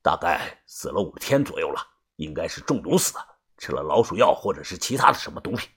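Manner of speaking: low, soft, thoughtful guess; frowning guess. A narrator's reading. Yu examining the corpse, low and frowning as he guesses. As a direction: weigh the clues under your breath. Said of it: "皱着眉推测地说道".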